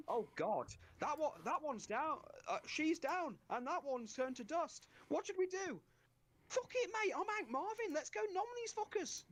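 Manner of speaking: high, hammed-up Cockney vampire; squeaky mock London vampire; bad Cockney accent. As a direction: play the panicked vampire teens in bad Cockney. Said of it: "these Eastern Europeans have a bad British accent".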